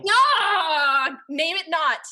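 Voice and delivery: loud, high shocked recoil; horrified protest. Acted. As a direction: cry out in prim horror. Recoils at mention of breeding.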